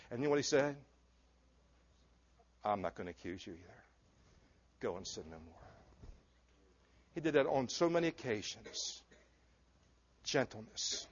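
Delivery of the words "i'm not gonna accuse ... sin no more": much softer and lower, gentle; tender forgiveness. Acted. Jesus to the woman caught in adultery, soft and forgiving. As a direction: release her softly, no accusation.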